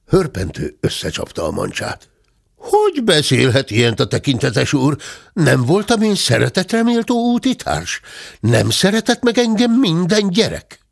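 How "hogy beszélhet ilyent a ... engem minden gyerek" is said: put-on high, hurt old servant protesting; a pitched-up, aggrieved servant voice; offended servant bear. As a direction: plead wounded and high. Bátya's offended plea.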